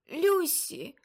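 high, loud sudden call of a name; sudden shout. Acted. Aunt suddenly shouting a name in trance. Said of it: "раптом вигукнув хтось".

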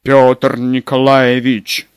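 slow, loud, moaning plea; groaning plea. Acted. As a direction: groan his name in agony. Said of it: "простонал".

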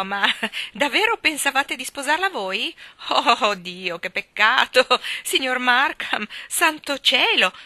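loud, high, laughing mock alarm; raucous mocking laughter. A narrator's reading. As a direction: cry out through gleeful laughter, feigning concern. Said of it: "scoppiò a ridere, tanto sguaiatamente". (risata sguaiata e canzonatoria)

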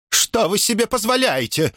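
high-pitched outraged housekeeper; scandalised, without raising the volume; indignant. A narrator's reading. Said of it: "возмутилась домоправительница".

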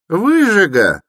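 very high, loud cry of abuse; woman's shout. A narrator's reading. Peasant woman abuses the stranger, high shout. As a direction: hurl the insult at top pitch. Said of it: "крикнула баба".

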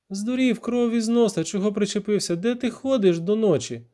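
high-pitched indignant woman's voice; indignant scolding. Acted. Віра scolding the narrator for coming late, indignant.

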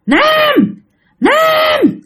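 very high, drawn-out cry of the name; resentful, furious calling. Retold or imitated. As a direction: call as if enraged at getting no answer. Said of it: "bắt đầu cái giọng hằn học".

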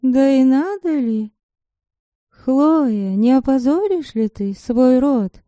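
a wry inner voice, lifted high; voice of conscience. A narrator's reading. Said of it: "внутренний голос".

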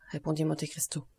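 usual speaking voice

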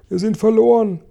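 very high cry of despair. A narrator's reading. Spider foresees the crash, despairing. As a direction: cry out as if doom is certain. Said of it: "Spinne rang ihre dünnen Spinnenbeine".

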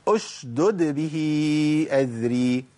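slow, both dals shown; correct recitation. Shown as a demonstration. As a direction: recite أشدد به with both dals clear, slowly. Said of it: "هنجيب قوله تعالى". (تلاوة صحيحة ببيان الدالين)

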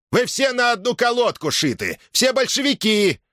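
high, heated heckle; accusing shout. A narrator's reading. Villager accuses all the soldiers of being Bolsheviks, shouting. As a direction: hurl the accusation, pitched high.

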